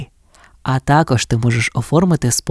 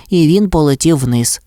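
plain reading voice

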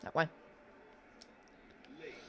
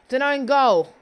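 loud, high burst of celebration; excited goal cheer; 6 semitones higher, 8 dB louder, slower than usual. Real, not acted. Gamer reacting to an own goal, excited cheer.

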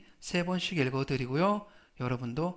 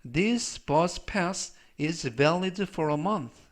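slow, measured English for learners; slow model reading; much slower than usual. Shown as a demonstration. Teacher reading an English example for learners, slow model reading. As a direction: read it out slowly as a pronunciation model.

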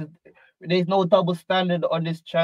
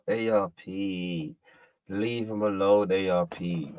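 low plea; 9 semitones lower, 6 dB quieter than usual. Real, not acted. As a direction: draw it out low and soft. Mock plea to leave him alone.

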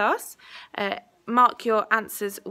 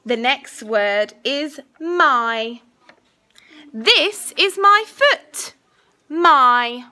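slow, clear dictation voice; school dictation; 3 semitones higher, 7 dB louder, much slower than usual. Acted. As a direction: say each word carefully for spelling. Teacher dictating a spelling test.

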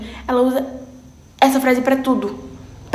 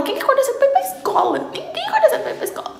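exasperated rant; 12 semitones higher than usual. Real, not acted. Ranting about waking up early.

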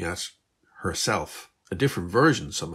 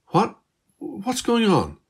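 high, slow stunned disbelief; girl stunned by turbines that should not exist, high and slow; shocked; 13 semitones higher, 5 dB louder, slower than usual. Acted. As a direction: gasp it out in disbelief.

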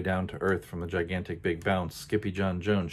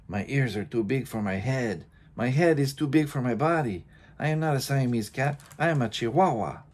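lightly put-on Spanish accent, rising higher; proud little Spanish accent, higher as it goes; 5 semitones higher, 4 dB louder than usual. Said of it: "using his very best Spanish accent".

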